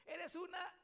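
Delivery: very high, loud cut-off cursing; hammed-up furious shriek; angry yelling. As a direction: scream insults in an acted quarrel. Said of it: "qué hacemos cuando estamos enojados".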